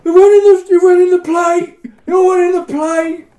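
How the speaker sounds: very high, loud hammed-up yell; mock pained shout. Player shouting that the shots hit the plate, hammed-up yell. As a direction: howl it like you're hurt and outraged. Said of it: "And I'm like".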